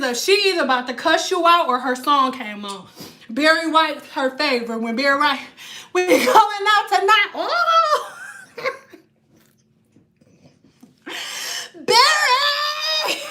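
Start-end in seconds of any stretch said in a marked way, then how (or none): 5.9-13.2 high, hammed-up fangirl squeal; swooning delight shooting way up in pitch; imitating thrilled grandma. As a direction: cry out for a favorite singer.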